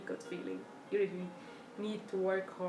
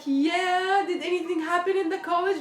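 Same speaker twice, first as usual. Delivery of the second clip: high loud mom's voice answering; mom's reply; 10 semitones higher, 10 dB louder than usual. Retold or imitated. Mom answering her daughter's call, raised high voice. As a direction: play mom calling back from the kitchen.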